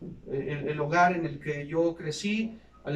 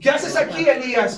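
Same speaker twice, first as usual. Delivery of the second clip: higher, louder, insistent repeat of the question; God demanding; 7 semitones higher, 8 dB louder than usual. Retold or imitated. God pressing Elijah in the cave, louder and higher. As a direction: press the question harder the second time.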